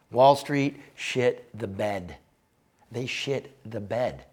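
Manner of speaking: drawn-out, heavy stress on each word; slow emphatic. Real, not acted. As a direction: lean hard on "shit the bed" slowly. Driving home a blunt point.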